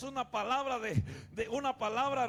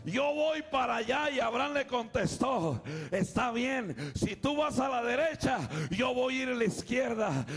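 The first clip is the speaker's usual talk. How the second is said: full-force preaching; 6 dB louder than usual. Acted. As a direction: preach it out loud to the whole church.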